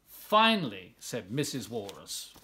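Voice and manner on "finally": exasperated relief. Acted. Mother relieved at last.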